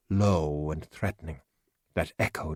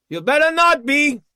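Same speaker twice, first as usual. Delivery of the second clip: much higher, much louder threat; warning shout; 10 dB louder than usual. Acted. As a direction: bark the warning from afar. Voice yelling from hut.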